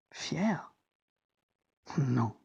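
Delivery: quiet questioning. Acted. Bitterly questioning his own pride.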